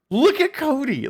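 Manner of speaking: high-pitched teasing streamer voiceover; put-on high voice, poking fun; mock voiceover. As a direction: imitate a streamer heckling the screen. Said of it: "like, doing the voiceover".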